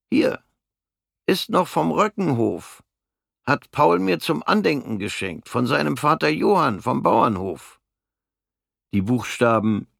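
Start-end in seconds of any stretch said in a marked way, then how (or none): 0.1-7.8 höhere, schlichte Stimme eines kleinen Mannes; kleiner Mann. Acted. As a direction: als harmloser kleiner Kerl von seinem Schicksal erzählen. Der kleine Mann zeigt den Löffel. (der kleine Mann erzählt Fritz von der Front, mit höherer Stimme)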